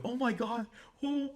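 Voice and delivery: high, light mock gasp of disbelief; imitating amused shock. Woman reacting to his dark joke, mock shocked. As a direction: squeal it softly like a giddy listener. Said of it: "And they're laughing".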